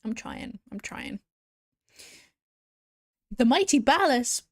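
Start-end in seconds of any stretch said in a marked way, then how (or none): 3.4-4.4 loud, high-pitched delighted hail; excited hail. Real, not acted. Streamer hailing a new follower called Ballas, excited. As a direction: hail them loudly like a celebrity. Surprised greeting of a famous-named follower.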